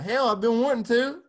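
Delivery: high, loud excited reply; retold thrilled exclamation; eager outburst. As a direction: burst out eagerly, pitch jumping up. Acted eager reply to offer.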